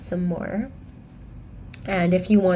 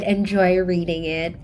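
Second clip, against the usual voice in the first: ordinary delivery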